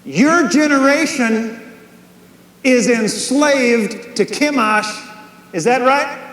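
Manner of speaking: preaching shout. Real, not acted.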